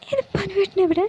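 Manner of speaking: put-on high, anxious young woman denying it; pleading Priya. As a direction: plead innocence, high and worried. (ഉയർന്ന, വേവലാതിയോടെയുള്ള നിഷേധം)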